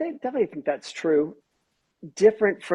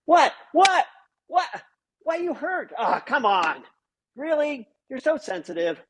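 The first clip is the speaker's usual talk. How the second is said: high, incredulous brushing-off; mocking a tone-deaf husband; dismissive disbelief; 11 semitones higher than usual. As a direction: act baffled by her hurt, pitch jumping up. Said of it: "I'm gonna go".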